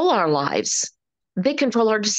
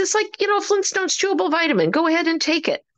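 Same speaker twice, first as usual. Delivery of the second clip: high bright coaxing; 9 semitones higher, faster than usual. Retold or imitated. Telling the lady with the sore knee, high eager pitch. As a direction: voice jumps way higher, selling it cheerfully. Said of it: "I said".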